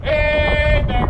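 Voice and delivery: yelled call. Shown as a demonstration. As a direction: yell it out with a long, drawn HEY. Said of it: "We will yell out".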